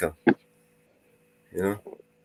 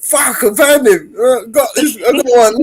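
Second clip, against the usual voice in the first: mock triumphant; 10 dB louder than usual. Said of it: "she might think".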